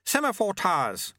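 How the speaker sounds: high-pitched call over the wind; shouted. A narrator's reading. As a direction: call out high, to carry over the rushing air. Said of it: "Lutze shouted".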